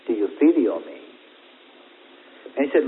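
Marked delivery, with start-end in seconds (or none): 0.1-1.0 a grieved lower repeat of the question. Acted. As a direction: lower and sadder asking. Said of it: "he was grieved".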